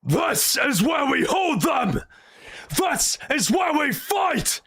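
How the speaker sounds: rally the troops before the fight, full voice; epic Spartan speech. Retold or imitated. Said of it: "compare that to a random line in three hundred".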